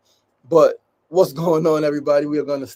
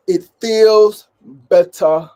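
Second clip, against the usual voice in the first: loud, clipped British "It feels better," with a higher pitch; UK accent; 3 semitones higher, 10 dB louder, much slower than usual. Shown as a demonstration. Trying out a British accent on his own line, in a UK accent. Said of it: "my U K accent".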